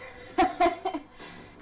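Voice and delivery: high wicked cackle. Acted. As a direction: let out a nasty cackle. Cackles at the story.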